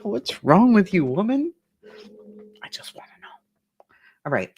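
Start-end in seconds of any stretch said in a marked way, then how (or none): 0.0-1.5 higher, louder outraged husband; a hint of a scandalised husband; mock husband. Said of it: "It's gonna be like". 2.6-3.3 whisper. Real, not acted. Whispered aside.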